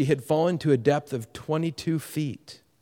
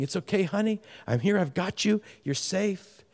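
put-on softer, gentle comfort to a frightened child; tender reassurance; 4 semitones higher, 5 dB quieter than usual. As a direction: hush her fears kindly. Rescuer soothing the toddler.

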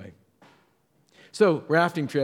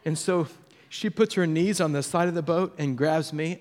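ordinary talk, nothing marked

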